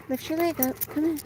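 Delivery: high, soft pet talk to the dog; soft high coaxing. Real, not acted. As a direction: coo at the dog.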